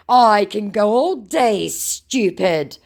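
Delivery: loud, high, slow taunt; mock scammer comeback. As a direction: imitate a defiant scammer.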